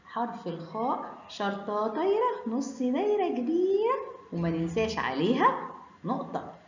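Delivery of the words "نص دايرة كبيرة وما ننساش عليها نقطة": normal talking voice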